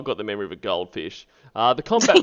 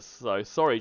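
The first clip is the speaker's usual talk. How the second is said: usual speaking voice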